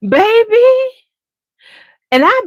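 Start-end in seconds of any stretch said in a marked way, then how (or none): 0.1-0.7 exclaim. Real, not acted. Reacting to awful food, loud high baby. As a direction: call out in disbelief.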